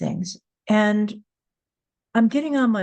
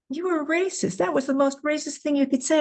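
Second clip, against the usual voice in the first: mock yell; 8 semitones higher than usual. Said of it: "he's yelling at me".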